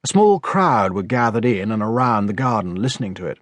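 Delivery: usual speaking voice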